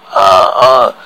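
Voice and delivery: low, voiced panted gasps; panting. A narrator's reading. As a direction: gasp out two low breaths. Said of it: "He panted".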